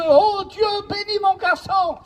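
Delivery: a father's retold cry to God. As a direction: cry it up to heaven for your son. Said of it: "il criait à Dieu".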